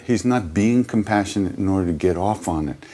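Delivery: ordinary delivery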